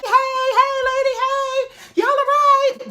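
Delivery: yelling "hey, lady" down the line. Retold or imitated. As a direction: call out to get the lady's attention. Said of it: "So I'm yelling like".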